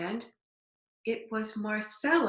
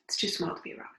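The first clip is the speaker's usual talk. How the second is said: softer whispered repeat; 2 semitones lower, 6 dB quieter, much faster than usual. Real, not acted. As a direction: drop to a whisper as you repeat it. Remark said twice, second whispered.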